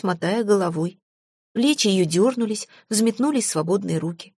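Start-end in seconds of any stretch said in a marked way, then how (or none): none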